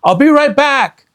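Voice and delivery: high, loud call back; boy calling up to his sister. Acted. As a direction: call it over your shoulder. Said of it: "Jack called".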